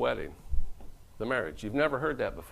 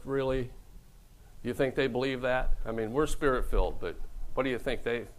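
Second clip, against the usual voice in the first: usual speaking voice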